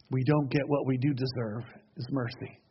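ordinary delivery

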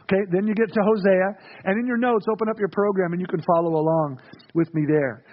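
ordinary talk, nothing marked

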